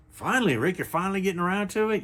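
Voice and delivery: high, fast mock-viewer voice; impatient viewer. Viewer teasing Rick about getting to the point, high and fast. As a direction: needle him like an eager fan.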